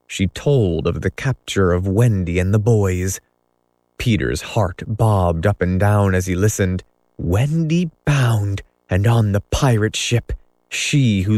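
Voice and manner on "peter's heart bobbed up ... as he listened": usual speaking voice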